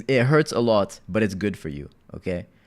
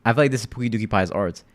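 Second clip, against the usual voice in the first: normal talking voice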